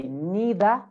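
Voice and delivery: British "neither" as "nee-thuh", no final R; British accent. Shown as a demonstration. Teacher models British "neither" for students, "nee-thuh". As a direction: say "neither" with long EE, R dropped. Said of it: "in British".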